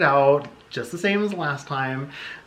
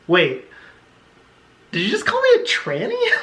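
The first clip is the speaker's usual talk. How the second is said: high, louder, indignant question; outraged disbelief; 8 semitones higher, 5 dB louder than usual. Retold or imitated. Confronting her date over the slur, high indignant disbelief. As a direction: shoot up high in offended shock.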